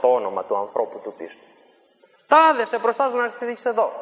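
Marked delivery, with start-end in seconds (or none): 2.3-3.9 high, loud command repeated; shouted summons. Retold or imitated. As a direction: order him to appear. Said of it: "και άρχισε να φωνάζει". (δυνατή προσταγή του μάγου)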